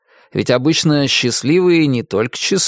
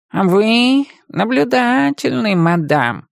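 sly cat; 10 semitones higher, slower than usual. Acted. Talking cat's voice.

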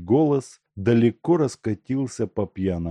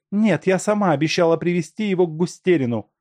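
high, fast, indignant protest; 10 semitones higher, 4 dB louder, faster than usual. A narrator's reading. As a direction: object hotly and quickly. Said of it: "протестовала Машка". (высокий быстрый протест)

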